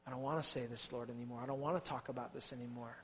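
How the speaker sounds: soft, low, worn-out admission; weary confession. Acted. Jeremiah confessing to God, soft and worn out. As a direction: barely get the words out, spent. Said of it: "he said".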